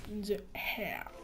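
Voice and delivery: mock English accent, quiet and throwaway. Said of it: "Avec mon superbe accent anglais". (accent anglais exagéré, pour rire, à mi-voix)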